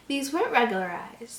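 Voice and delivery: ordinary delivery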